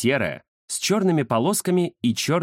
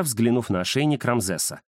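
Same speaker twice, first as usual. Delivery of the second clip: ordinary delivery, nothing marked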